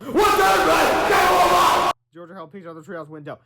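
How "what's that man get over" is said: very high, loud panicked yelling; panicked shout. A narrator's reading. The boys waking late, high panicked shouting. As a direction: scream it in total panic. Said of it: "Harold wailed".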